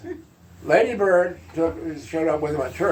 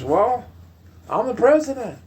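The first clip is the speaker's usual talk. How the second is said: put-on high, showy Texas accent; Lyndon Johnson; 8 semitones higher than usual. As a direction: announce yourself in a big Texas accent. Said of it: "pronounced Texas accent like that".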